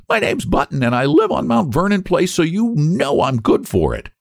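very high, insistent treble; indignant retort. A narrator's reading. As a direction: pipe up indignantly, pitched way high. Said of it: "he retorted angrily".

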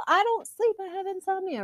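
put-on squeaky high girly whine; high whiny voice. Exaggerated girl talk.

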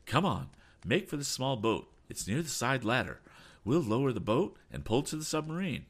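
high, urgent orders; excited captain directing an escape; crying out orders. A narrator's reading. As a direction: hurry the crew along. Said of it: "cried Captain Weston".

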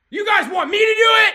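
high, loud, rapid-fire threat; furious shout. Real, not acted. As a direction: shout the challenge at them.

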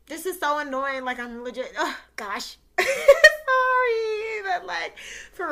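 real tears, voice cracking into a loud teary laugh-sob; overwhelmed, the thanks spill into crying; breaking into tears. Real, not acted. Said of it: "I'm about to cry".

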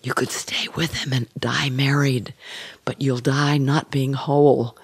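angel's hushed voice at the shoulder; soft whisper. Acted. Angel on one shoulder counselling Jane about her marriage, soft whisper. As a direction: whisper it softly, slipping out of full voice. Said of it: "with a very soft whisper saying".

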